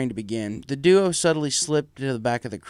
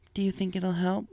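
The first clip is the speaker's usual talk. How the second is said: higher, soft mare voice asking privately; quiet private question; 7 semitones higher, 4 dB quieter than usual. Acted. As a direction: ask her husband quietly, hopeful. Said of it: "Firefly asked privately".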